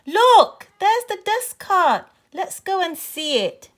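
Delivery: high, loud excited shout. A narrator's reading. Boy spotting the dust cart, excited shout to friends. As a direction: burst out loudly, then ease a little. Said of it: "shouted out".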